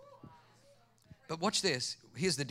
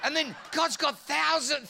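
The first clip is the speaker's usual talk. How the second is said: ordinary talk, nothing marked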